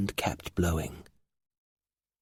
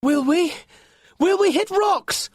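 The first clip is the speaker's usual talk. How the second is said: high, loud anxious shout; worried question; 5 dB louder than usual. Acted. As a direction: afraid of the rocks, calling out. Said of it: "asked Lorca".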